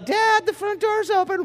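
put-on a little kid reporting to dad; tattling kid. Kid voiced in acted morning scene.

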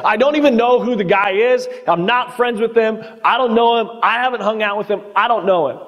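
high-pitched imitated protest; Peter's denial. Preacher acts out Peter denying Jesus, protesting denial. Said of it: "the one that denied Jesus".

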